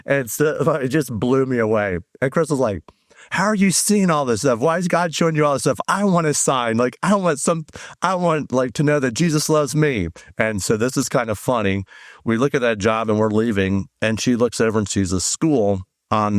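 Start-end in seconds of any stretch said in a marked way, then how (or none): 3.3-10.1 higher-pitched imitation of an exasperated friend; mock exasperated pleading. Friend complains to the speaker about wanting a sign too, mock exasperated. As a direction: plead like someone feeling left out, pitched up.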